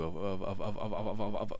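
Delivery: tripping over repeated words; stuttering. Real, not acted. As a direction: stutter out of frustration. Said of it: "I'm stuttering already".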